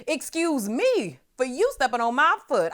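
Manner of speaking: high, loud, exaggerated politeness; hammed-up cheerful pardon; mock apology. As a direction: gush an over-polite excuse me. Acted over-polite apology to offender.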